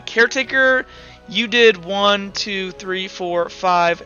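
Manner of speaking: ordinary talk, nothing marked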